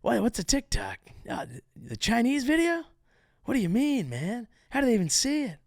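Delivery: high, put-on baffled questioning; mock intern impression. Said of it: "I wonder if the interns started doing that".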